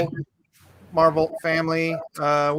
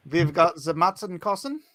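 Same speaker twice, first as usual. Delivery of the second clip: put-on German with rising finish; mock German accent; 3 semitones higher than usual. Host introduces Matt Kossen to the stream, mock German accent. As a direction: ham up the guest's name as fake German. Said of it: "I don't know why I'm talking like this".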